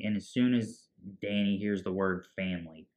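ordinary talk, nothing marked

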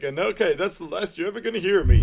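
hammed-up breakup threat down the phone; mock dramatic farewell. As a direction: slam the door with your voice. Dale's dramatic phone goodbye.

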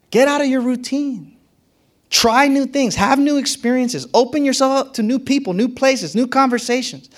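fired-up call to change; rising exhortation. Real, not acted. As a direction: preach it up, urging the crowd. Preacher builds to exhortation.

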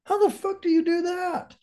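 high-pitched baffled squeak; incredulous disbelief. Retold or imitated. Recalling his shock at Robo's advice, incredulous. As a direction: pitch way up, stunned he can just do it. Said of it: "I was like".

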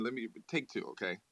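normal talking voice

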